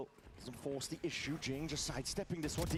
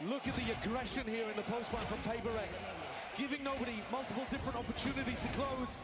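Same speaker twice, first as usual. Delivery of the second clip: much higher, hyped play-by-play; excited; 11 semitones higher than usual. Real, not acted. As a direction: keep the energy sky high. Post-clutch hype commentary.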